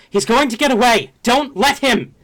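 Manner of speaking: high, loud, fast battle yell; urgent shout back. Acted. Sanguine Blade warns an ally of the escape, urgent high shout. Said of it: "shoots back".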